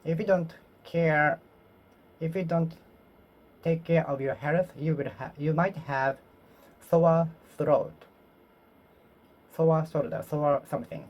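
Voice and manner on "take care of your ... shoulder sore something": ordinary talk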